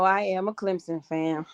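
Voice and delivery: ordinary delivery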